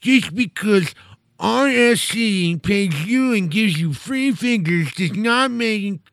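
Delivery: high, whiny, slow mocking rant; basement nerd voice. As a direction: read it like a whiny man-child. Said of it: "read a review like these people probably look".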